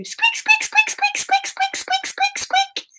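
very high, tiny repeated squeaks; piping mouse noises. Pretend. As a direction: squeak rapidly like a teeny mouse. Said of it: "what noise do mice make?".

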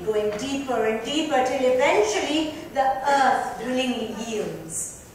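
ordinary delivery

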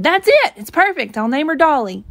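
high, loud burst of delight, easing off; excited shout. A narrator's reading. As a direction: shout it like a sudden discovery. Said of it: "I shouted".

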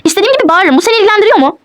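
high-pitched, indignant comeback. Acted. Wife answering husband who asked her not to shout, defiant. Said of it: "bağırma".

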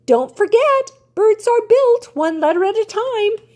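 high, carrying call from the sky. A narrator's reading. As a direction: call it back as she flies off. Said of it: "she called".

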